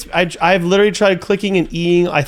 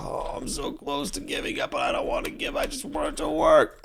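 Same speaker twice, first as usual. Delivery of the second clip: breathy despairing whisper, much softer; defeated whisper; 10 dB quieter than usual. Real, not acted. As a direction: whisper it, on the edge of giving up. Near giving up, defeated.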